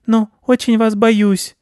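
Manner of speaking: very high, slow squeaky mouse; little mouse humbling itself; timid mouse. Acted. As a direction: confess it timidly in a tiny piping voice.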